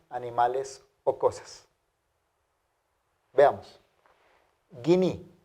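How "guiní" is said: guiní said slowly and clearly, gui-ní; Greek word demo. Shown as a demonstration. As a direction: pronounce guiní as a teacher would, hard g. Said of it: "Noten la pronunciación de la ípsilon". (pronuncia guiní con g fuerte, "gui-ní")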